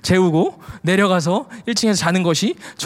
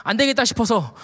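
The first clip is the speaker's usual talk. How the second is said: ordinary talk, nothing marked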